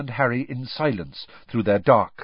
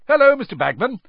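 higher and louder, cheery; bright greeting; 9 dB louder, faster than usual. A narrator's reading. Fred cheerily greeting Bagman in the pub, bright. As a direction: greet him with bright enthusiasm. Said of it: "said Fred brightly".

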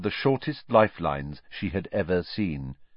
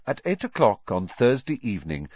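ordinary delivery

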